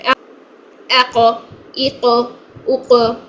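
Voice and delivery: echoing bounce on the final क़; क़ stopped, then released with a bounce; qalqala on qaf. Shown as a demonstration. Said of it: "इस तरह से हम इसकी अदायगी करेंगे".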